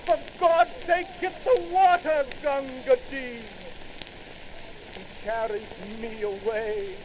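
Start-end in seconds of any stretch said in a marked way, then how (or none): 0.1-3.4 higher, louder urgent cry; desperate plea. Acted. Dying soldier pleading with Gunga Din for water, urgent shout. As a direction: beg for the water with all you have left. Wounded man begs for water.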